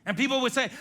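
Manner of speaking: ordinary delivery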